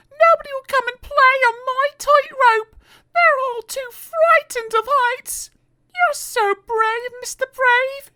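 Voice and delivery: very high, slow hammed-up tearful plea; mock sobbing. Miss Somersault pleading with Mister Brave, very high tearful voice. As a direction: whimper your loneliness up high. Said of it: "she sobbed".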